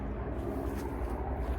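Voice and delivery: sharp breathless gasp of fright; startled gasp. Real, not acted. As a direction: gasp as if a bird flew at you. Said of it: "I thought that was a bird, and I'm scared of birds".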